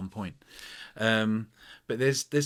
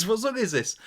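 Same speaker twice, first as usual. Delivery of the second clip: high customer voice; 11 semitones higher, 5 dB louder than usual. Retold or imitated. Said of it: "she said".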